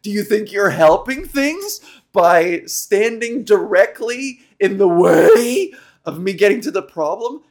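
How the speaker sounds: loud, sarcastic retort; irritated viewer mocking a rambling host; sarcastic outburst. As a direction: snap back loudly with scorn. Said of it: "And I'm like".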